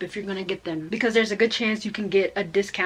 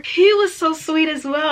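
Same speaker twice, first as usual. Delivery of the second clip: ordinary talk, nothing marked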